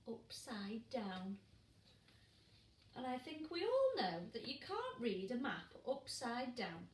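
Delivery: plain reading voice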